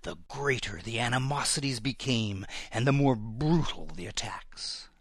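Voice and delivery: usual speaking voice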